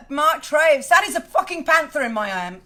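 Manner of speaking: loud, excited, slowing over the names; excited announcement. Real, not acted. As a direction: show off the big cat, thrilled. Showing off the panther.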